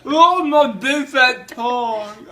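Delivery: laughing. Real, not acted. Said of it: "Dad, you sound like something else".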